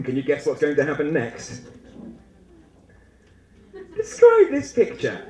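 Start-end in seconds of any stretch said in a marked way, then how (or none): 4.0-5.2 high, loud exam prompt; teacher or examiner. Acted. Examiner giving the student a picture task, high and loud. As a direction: put on an examiner's instruction voice. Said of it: "says the teacher or examiner".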